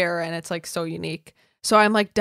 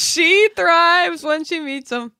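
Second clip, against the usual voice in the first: mock announcer tease; 9 semitones higher, 7 dB louder, slower than usual. Teasing cohost in put-on voice.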